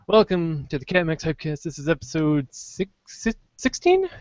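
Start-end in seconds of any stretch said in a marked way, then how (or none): 2.5-4.1 unsure. Real, not acted. Unsure of episode number.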